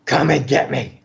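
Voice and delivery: harsh devil-like taunt; menacing, demonic mock yell; imitated angry scream. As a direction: dare them from behind a door. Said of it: "in that same voice".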